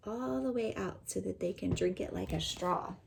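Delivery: ordinary talk, nothing marked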